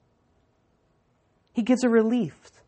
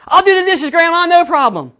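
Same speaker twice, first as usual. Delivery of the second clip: higher, louder, over-eager chirp; overeager; 8 semitones higher, 8 dB louder than usual. Retold or imitated. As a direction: hurry to sound helpful so she won't tell. Boy caught, eager to please.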